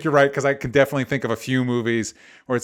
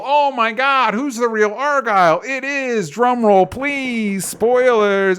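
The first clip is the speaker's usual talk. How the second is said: mock drumroll hype; 8 semitones higher, 3 dB louder, slower than usual. Hyping the big twist reveal.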